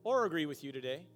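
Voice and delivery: loud, driving pulpit delivery; preaching at full force. Acted. Pastor preaching end-times signs to the congregation, loud and driving. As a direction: hammer each sign home to the room.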